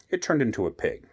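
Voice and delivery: ordinary delivery, nothing marked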